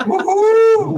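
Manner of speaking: loud, high-pitched whoop; real victory whoop, sailing up high; excited cheer. Real, not acted. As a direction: cheer the win at full voice.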